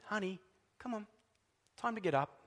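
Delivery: a hint of a father softly waking his child; tender father. Father at the bedside waking his daughter, softly. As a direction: gently coax a sleepy child up. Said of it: "It's like the father sitting by the bed".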